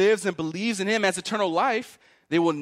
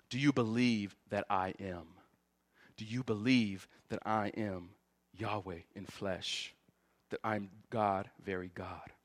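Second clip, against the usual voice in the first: low, soft, weighty questioning; quietly grave preacher's appeal, sinking softer to the end; hushed solemnity; 9 semitones lower, 10 dB quieter than usual. Acted. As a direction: drop right down, reverent and measured.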